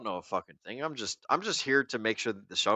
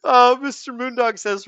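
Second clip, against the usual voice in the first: laughing; 13 semitones higher, 8 dB louder than usual. Real, not acted. Laughing while reading chat.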